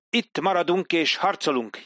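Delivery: defiant Indian prince. Acted. Benny Singh defiant in dialogue.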